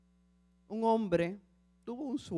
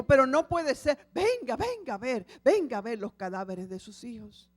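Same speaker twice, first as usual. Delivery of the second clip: much louder, insistent protest; distraught father insisting; anguished protest; 2 semitones higher, 6 dB louder than usual. Acted. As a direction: demand they show you.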